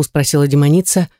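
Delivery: ordinary delivery, nothing marked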